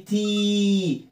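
"تي" drawn out as "teeee"; high, sustained "تي"; long E demo. Shown as a demonstration. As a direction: hold the vowel in "تي". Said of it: "اعملها لونج إي".

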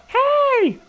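high shrill mock yell of hey; retold screaming. Teacher screaming at parents in car line, mock yell. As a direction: imitate her yelling hey from afar. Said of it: "Like, screaming".

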